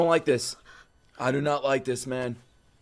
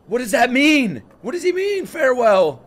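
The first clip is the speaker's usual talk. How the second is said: higher, louder anxious questions; alarmed questioning; 6 semitones higher, 5 dB louder than usual. Real, not acted. Fan alarmed at a character saying farewell. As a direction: demand an answer, rising alarm.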